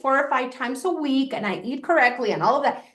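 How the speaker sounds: ordinary talk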